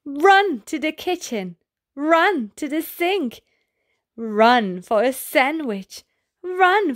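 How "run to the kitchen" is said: ordinary delivery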